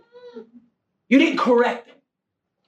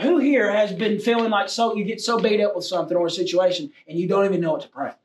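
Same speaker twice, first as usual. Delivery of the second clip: ordinary talk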